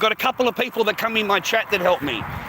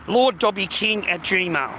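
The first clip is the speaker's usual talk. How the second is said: slowly spelling out an email address; each word spaced so viewers can note it; slow dictation; slower than usual. Real, not acted. Streamer slowly reads his PayPal email to viewers. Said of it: "That's my PayPal".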